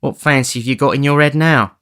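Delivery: jolly him along; young woman coaxing a grumpy man; Nancy. Acted. Nancy teases Sikes.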